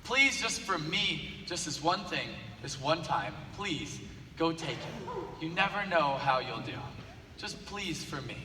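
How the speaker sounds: quiet, slow begging, almost hushed; a teacher pleading softly with a failing student. Retold or imitated. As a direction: coax him gently, just for me. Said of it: "she begged him and begged him".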